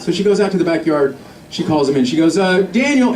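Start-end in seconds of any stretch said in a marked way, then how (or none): 2.4-3.1 tentative mom calling her son, slightly raised pitch; hesitant mock-mom call. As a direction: imitate a mother calling out hesitantly. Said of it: "you can hear her hesitating".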